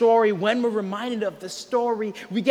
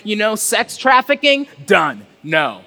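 full-force preaching; 2 semitones higher, 6 dB louder than usual. Acted.

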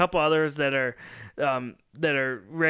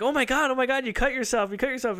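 higher-pitched retold panic; mock alarm; 8 semitones higher than usual. Family reacting to Dan's bloody hands, mock alarm. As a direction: imitate someone flustered at an injury.

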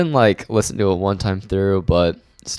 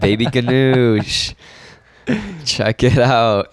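DJ announcer voice; 4 semitones higher, 3 dB louder, slower than usual. Pretend. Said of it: "Oh, my D J name?".

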